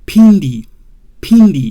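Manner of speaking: Pindi with a firm P and a short i; correct Pindi. Shown as a demonstration. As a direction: say Pindi, leaving the M silent. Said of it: "That's why it make you say".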